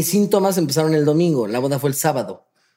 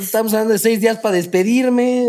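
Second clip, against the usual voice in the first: laughing; 6 semitones higher than usual. Retold or imitated. Retelling his dramatic fear, laughing.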